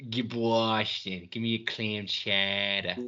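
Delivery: slow, drawn-out mock Boston accent; hammed-up Boston chowder order; lightly put-on Boston accent. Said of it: "The worst accent of all time".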